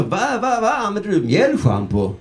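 high-pitched mock bafflement; incredulous question. Friend questioning a man's dandruff shampoo, mock incredulous.

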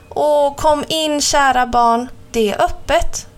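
put-on the true grandma invites the girl in; grandma's voice. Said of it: "sa mormor".

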